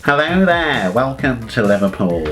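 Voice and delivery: nasal Scouse greeting, as if pinching the nose; put-on nasal Liverpool welcome; nose-held Liverpool accent. Shown as a demonstration. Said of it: "to hold your nose".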